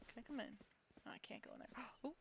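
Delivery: hushed whisper. Real, not acted. Owner whispering at a doorway to her pets, hushed whisper. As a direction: whisper so the pets stay undisturbed.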